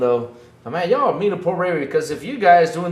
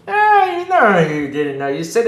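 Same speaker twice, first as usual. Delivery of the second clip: ordinary talk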